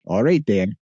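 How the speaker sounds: a light mock Minnesota "Ooh, okay"; playful imitation of Minnesota speech; gently hammed-up Upper Midwest accent. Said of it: "I go to Minnesota and I just say".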